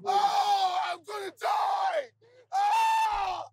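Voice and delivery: desperate high-pitched screaming. Real, not acted. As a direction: scream in real frustration. Screaming in frustration at game.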